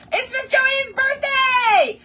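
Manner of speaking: high, loud, drawn-out cheer; slow, pealing celebratory shout; excited announcement. Real, not acted. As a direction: proclaim a birthday to everyone. Announcing a viewer's birthday.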